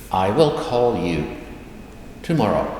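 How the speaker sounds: word by word, pause before the last word; careful translation. Shown as a demonstration. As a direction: spell it out for learners, with a break. Teacher translates slowly, then repeats.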